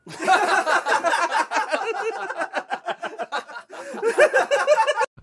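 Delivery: bursting into loud laughter; big cackle, high and loud. Real, not acted. Laughs at own story.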